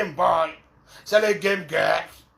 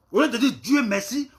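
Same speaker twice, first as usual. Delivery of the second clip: ordinary talk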